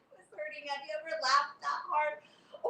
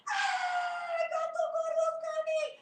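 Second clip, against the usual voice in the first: screaming; slower than usual. Acted. Kids screaming as the cat is about to pounce, screaming. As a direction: scream in terror. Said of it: "they screamed".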